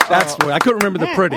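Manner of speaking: normal talking voice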